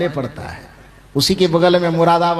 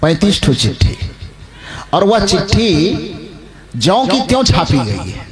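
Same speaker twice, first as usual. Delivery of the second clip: louder, deliberate sermon delivery; raised preaching voice; emphatic preaching; 4 dB louder than usual. Acted. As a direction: press the point home to the congregation. Preacher emphasising the printed letters.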